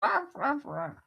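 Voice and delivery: high cute critter growls; tiny creature noises; little animal sounds. Acted. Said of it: "his little animal sounds".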